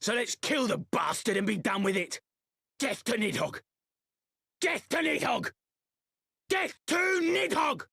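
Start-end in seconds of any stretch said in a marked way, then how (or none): 2.8-7.8 building from high call to full shout; war cry. Acted. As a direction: start a battle chant, rising each time. War chant begins and builds.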